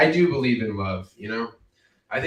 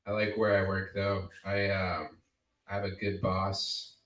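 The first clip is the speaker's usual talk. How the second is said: ordinary talk, nothing marked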